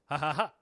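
three deliberate, spaced ha's; forced laugh, building a little; imitated fake laugh. Other guy in the video answering, imitated fake laugh. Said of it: "the other guy goes".

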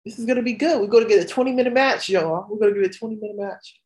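high, fast and eager; hyped fan. Retold or imitated. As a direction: rattle it off, buzzing for a long match. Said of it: "I'm like".

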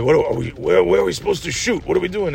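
lightly voiced confused actor; a hint of a baffled movie star; mildly put-on puzzled questioning, pitch climbing; Al Pacino. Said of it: "he's like".

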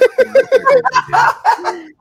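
big high laugh; high burst of laughter. Real, not acted. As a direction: crack up loudly. Laughing at the joke.

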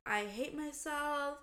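retold tearful misery, slow and soft; mock breakdown. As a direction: imitate yourself crying in self-pity. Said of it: "having a full mental breakdown".

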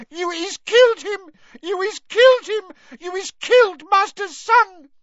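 high, loud keening elf wail; wailing house-elf. Acted. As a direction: wail in grief and accusation. House-elf wailing over her master.

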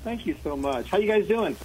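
faint, hollow phone line; distant voice down a well. Real, not acted. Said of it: "you sound like you're at the bottom of a well".

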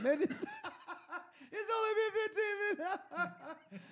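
high squeal through laughter; laughing disbelief. Retold or imitated. Friend retelling a giggling fit at the clock, high laughing disbelief. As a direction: squeeze it out high while cracking up.

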